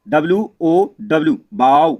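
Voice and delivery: slow, spelled letter by letter, then बाउ said loud with a long ऊ; spelling demo. Shown as a demonstration. Teacher spelling बाउ slowly to students, then stressing the long ऊ. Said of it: "बड़ा ऊ से पढ़ना है".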